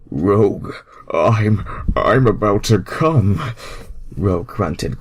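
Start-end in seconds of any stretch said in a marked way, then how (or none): 0.1-3.4 higher and louder, straining; a hint of a straining male voice; Sonic grunting. A narrator's reading. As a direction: push the words out near the edge. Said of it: "Sonic grunted".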